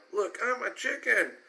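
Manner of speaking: put-on high, silly kid voice; a kid clowning as a chicken; playful filly voice. A narrator's reading.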